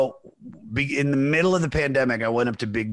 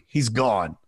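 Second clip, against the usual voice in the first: ordinary talk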